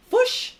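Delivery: "fush" for fish, high and loud; attempted Kiwi vowel. Shown as a demonstration. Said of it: "not even getting the accent right".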